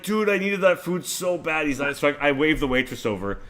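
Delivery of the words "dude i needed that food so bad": higher, whiny complaint; mock whine. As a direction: whine like the food was everything. Pleading over the lost food.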